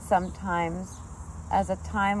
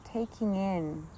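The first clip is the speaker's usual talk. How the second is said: guided meditation; 10 dB quieter than usual. Real, not acted. Teacher guiding sitters to relax with the sounds, soft meditative voice. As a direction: ease them gently into stillness, softly.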